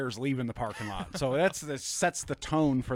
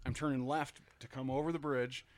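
plain reading voice

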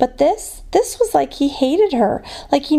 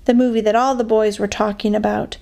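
ordinary delivery

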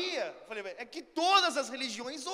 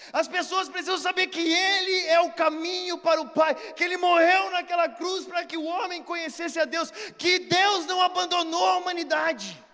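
louder, soaring preacher's proclamation; preaching at full force; 5 semitones higher, 7 dB louder than usual. Acted. Preacher urging the world to know Jesus, fervent shout. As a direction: preach it out to the whole congregation.

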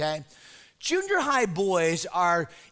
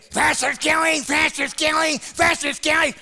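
high, loud chant of faster; yelling kid; 6 semitones higher, 4 dB louder than usual. Retold or imitated. Bobby the junior high boy chasing his pastor, loud high chant. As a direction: shout it like an eager kid chasing you.

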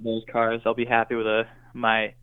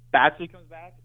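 higher and louder, insisting; emphatic repeat; 5 semitones higher, 5 dB louder than usual. Real, not acted. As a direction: say it again with eager emphasis. Repeats his wish emphatically.

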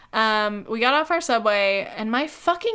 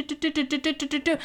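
higher, rhythmic do-do-do patter; imitated busy rhythm; 4 semitones higher, faster than usual. Barista imitates slinging drinks in a rush, rhythmic patter. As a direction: rattle off nonsense beats like working in a groove.

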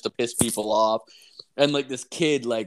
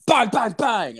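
punched-out, high imitation of door banging; loud banging; 10 semitones higher, 5 dB louder, slower than usual. Telling friend how she pounded the door, loud banging. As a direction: hit each bang hard, pitched up. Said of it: "It wasn't a knock at the door".

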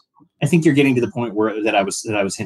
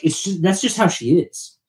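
mock excuse; 10 semitones higher, slower than usual. Judo excusing Shara, acted quote.

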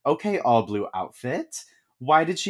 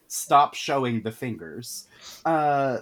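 ordinary talk

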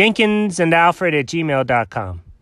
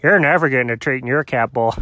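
plain reading voice

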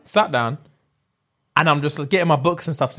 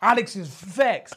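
normal talking voice

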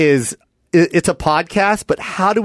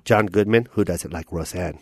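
low-voiced dad; father; 10 semitones lower, 5 dB quieter than usual. Dad praising the movie's cast, in a low voice. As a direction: drop into a deep father voice. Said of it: "I'm gonna play your dad".

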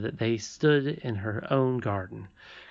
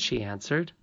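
plain reading voice

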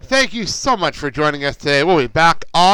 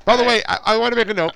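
ordinary talk, nothing marked

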